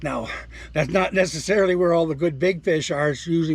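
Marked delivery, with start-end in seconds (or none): none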